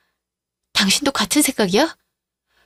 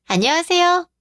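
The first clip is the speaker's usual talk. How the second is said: sugary greeting; 7 semitones higher than usual. Acted. Mistress greets wife sweetly.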